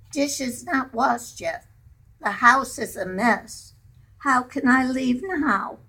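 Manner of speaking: lightly voiced mother fussing about chores; Mama protesting. Mama protesting she cannot leave the housework, mildly voiced. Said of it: "she would always protest".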